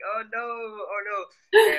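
high, drawn-out mock dismay; cringing dismay. Scots cringing at a fellow Scot on TV, long mock wail. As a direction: draw out each word high and loud, hammed-up. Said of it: "we're just like".

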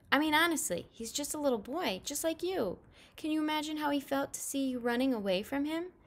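high-pitched, upset mom voice; reproachful mother. A narrator's reading. Mom asks Jamie to imagine August's feelings, reproachful. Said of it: "like how she sounded".

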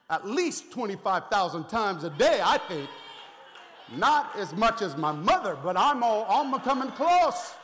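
loud, driving preacher's cry; fired-up pulpit delivery; preaching at full force. Acted. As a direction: rouse the congregation at full voice.

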